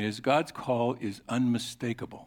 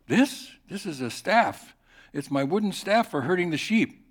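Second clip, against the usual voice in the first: puzzled Moses; 6 semitones higher, 3 dB louder than usual. Acted. Moses answers God's question.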